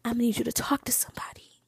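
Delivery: breathy whispered ASMR voice, a little quick. Shown as a demonstration. Telling a guy with exes to get therapy, in ASMR whisper. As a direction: whisper it close to the mic like an ASMR video. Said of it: "in my A-, A S M R voice".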